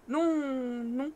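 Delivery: bredouillé, voix un peu altérée; balbutiant. Acted. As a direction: bafouille de peur. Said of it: "balbutie Barnabé". (Barnabé balbutie, effrayé)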